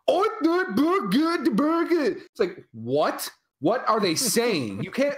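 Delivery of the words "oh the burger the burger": put-on over-the-top Scottish accent. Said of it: "sound like the most stereotypical, like, over-the-top Scottish accents ever".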